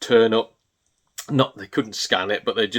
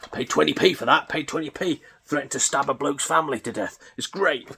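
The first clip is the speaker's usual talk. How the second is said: mocking bargain hunter; 3 semitones higher, 4 dB quieter than usual. Imitating resellers boasting online about bargains, mocking. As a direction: send up a smug reseller.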